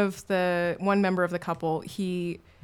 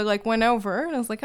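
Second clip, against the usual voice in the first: ordinary talk, nothing marked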